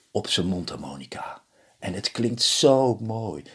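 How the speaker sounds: ordinary delivery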